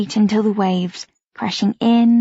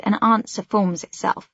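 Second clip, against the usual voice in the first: ordinary delivery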